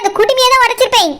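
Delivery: ordinary talk, nothing marked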